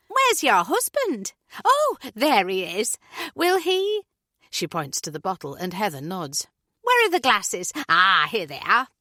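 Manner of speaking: breeze in all eager and chirpy; bubbly neighbour Julie. Acted. Julie bustling through kitchen.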